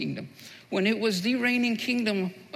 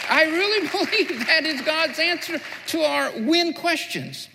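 preaching; 5 semitones higher, 3 dB louder than usual. Acted. Preaching builds to full force.

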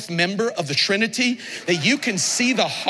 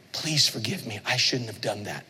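quiet, lower, humble plea for forgiveness; soft apology; 11 semitones lower, 6 dB quieter than usual. Retold or imitated. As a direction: say it softly, head bowed in sorry. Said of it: "she goes".

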